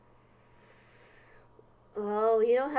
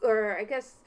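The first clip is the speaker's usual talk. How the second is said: usual speaking voice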